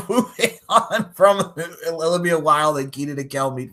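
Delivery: talking through laughter, slow; laughing. Real, not acted. Podcaster moving on from the off-topic chat, laughing. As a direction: laugh your way through the words. Laughing while changing the subject.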